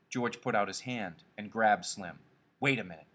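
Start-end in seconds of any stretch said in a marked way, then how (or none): none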